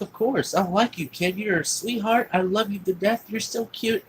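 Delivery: high-pitched, doting praise; gushing affection. Retold or imitated. Woman reassures the boy she likes him, gushing affection. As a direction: imitate a woman fussing over a sweet child. Woman gushes over the kid.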